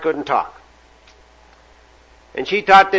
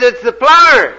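higher, louder door call; plumber; 8 semitones higher, 7 dB louder than usual. Acted. Plumber answering the parrot through the door, raised call. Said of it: "And he says".

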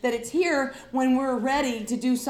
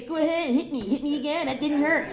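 defiant taunt; 4 semitones higher than usual. Retold or imitated. Defiant teen taunting parents.

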